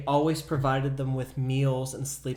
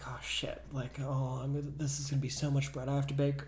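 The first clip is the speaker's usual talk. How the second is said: muttered under breath; 7 dB quieter than usual. Retold or imitated. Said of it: "So she said".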